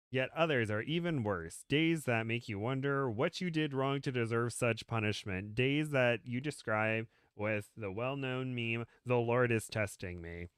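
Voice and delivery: measured, lilting read-aloud; story narration. Acted. Reading a comic story to a friend, storyteller delivery. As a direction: read it out with swooping storyteller emphasis.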